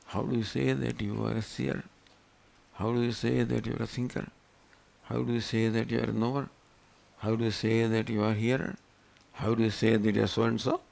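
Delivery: soft, low-key, even litany of questions; quiet questioning. Shown as a demonstration. Teacher questioning students on who they claim to be, quiet questioning. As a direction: ask each question softly and evenly.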